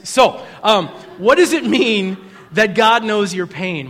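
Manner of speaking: ordinary talk, nothing marked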